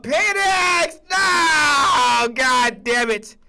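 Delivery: high, loud cry of despair, dragged out at the end; anguished outcry. Real, not acted. Fan crying out over his quarterback, loud and anguished. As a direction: wail it like your team just blew it.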